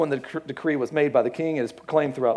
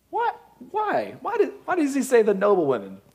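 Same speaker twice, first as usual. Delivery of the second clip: sudden much higher "What?", laughing into the question; mock surprise; 8 semitones higher than usual. Real, not acted. Preacher puzzling over the text, amused mock astonishment. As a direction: jump up in pitch, amused disbelief. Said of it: "mock puzzlement".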